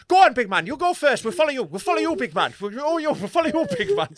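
high, loud Irish urging; hammed-up frightened Irish traveller voice; scared Irish traveller. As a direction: egg someone on nervously in an Irish accent. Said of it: "they're shit scared".

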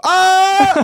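high, loud panicked shriek; panicked scream. Retold or imitated. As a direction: scream like you've been caught. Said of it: "och så bara".

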